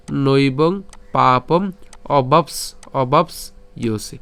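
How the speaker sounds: পাপম অবাপস ইয়সি slowly, in split syllables. Shown as a demonstration.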